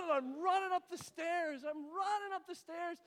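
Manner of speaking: loud, high, worked-up telling; frantic narration. Retold or imitated. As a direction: tell it like a frantic dash. Acts frantic dash upstairs.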